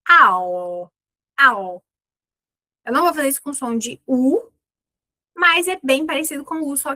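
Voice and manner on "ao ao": a dark L, the tongue pulled back like a choked U; dark L sound alone. Shown as a demonstration. Teacher demonstrating the dark L to students, choked U sound. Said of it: "eu vou fazer".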